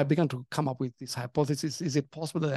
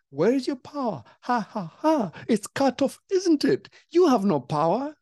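taunting Satan voice; 8 semitones higher than usual. Retold or imitated. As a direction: gloat over the cut-off power. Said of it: "That was Satan speaking".

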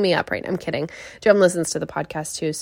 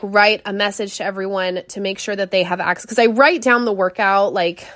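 ordinary talk, nothing marked

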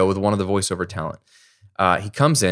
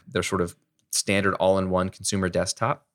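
usual speaking voice

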